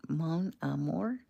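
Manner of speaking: quiet, questioning French accent. Shown as a demonstration. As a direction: attempt "Mon amour" slowly in a French accent. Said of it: "French accent".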